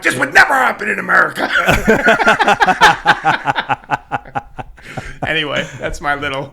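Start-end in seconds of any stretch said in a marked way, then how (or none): 0.0-1.5 mock indignation. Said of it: "I'm like". 1.5-5.2 long laugh. Real, not acted. Long laugh after story.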